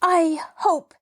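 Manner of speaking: high, deliberate and haughty; summoned dignity. A narrator's reading. Mabel's parting shot at the landlord, with wounded dignity. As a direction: draw yourself up for a parting shot. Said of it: "summoning all her dignity for a parting shot".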